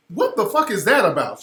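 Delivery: normal talking voice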